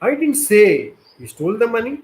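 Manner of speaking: slow delivery, high on say; stress on say. Shown as a demonstration. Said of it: "third word, say".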